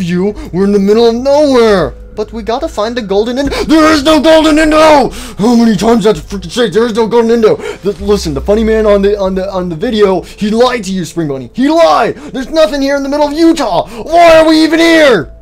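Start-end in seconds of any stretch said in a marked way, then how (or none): 3.7-7.6 loud, higher, fast angry yelling; furious shout. Acted. Fudball furious at Spring Bunny. 11.5-15.4 loud, higher, fast angry yelling; furious shout. Acted. Fudball ranting.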